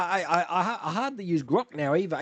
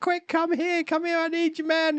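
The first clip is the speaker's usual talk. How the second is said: high, urgent plea; mock desperate cry for help; urgent calling; 13 semitones higher than usual. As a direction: call out in a high, pleading voice. Retold advert, injured man calling.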